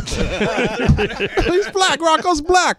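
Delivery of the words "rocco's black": ordinary talk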